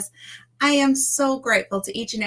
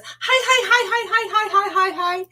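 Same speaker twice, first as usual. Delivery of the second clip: very high, bouncy hi-hi-hi in a cartoonish voice; squeaky greeting; 12 semitones higher than usual. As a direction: chirp it out like an overexcited little character. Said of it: "He slides in and says".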